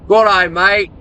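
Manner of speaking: put-on slightly off Australian accent; amateur Australian accent. Streamer greeting an Australian viewer, amateur Australian accent. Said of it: "my Australian accent probably ain't too good".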